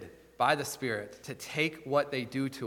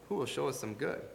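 softer, distant repeat of the question; a softer repeat trailing off; softer echo; 7 dB quieter, faster than usual. Retold or imitated. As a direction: let the plea fade away like an echo. Echoing the enemies' plea.

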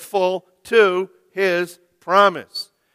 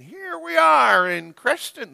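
high, bright announcer imitation; TV parade announcer; 10 semitones higher than usual. Pastor imitating the herbicide commercial's parade man, high announcer voice. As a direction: mimic the commercial's parade guy. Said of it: "That's how he talked".

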